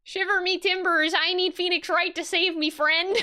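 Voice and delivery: high, hammed-up pirate about to crack up. Pirate client asking Phoenix Wright for help, amateur pirate. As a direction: try a pirate voice, pitched high. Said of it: "how does one speak in pirate?".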